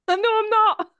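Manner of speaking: very high, loud mimicry; mocking echo. Co-host parroting her friend's impression of her, mocking high squeal. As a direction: squeal it back through laughter.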